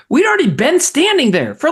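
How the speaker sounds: ordinary talk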